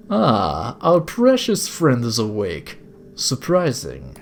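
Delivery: slow, drawn-out villain with a put-on accent; badly accented sinister gentleman; villainous older captor. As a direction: savour it like a courteous kidnapper. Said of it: "sorry if this is a bad accent".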